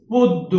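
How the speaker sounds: পদ্ম said "poddo", first vowel as o; পদ্ম with a rounded o and doubled d; ওকার in পদ্ম. Shown as a demonstration. Teacher to students modelling the word, পদ্ম as "poddo". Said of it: "এই যে ওকার হয়ে যাবে".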